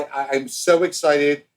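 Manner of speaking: ordinary talk, nothing marked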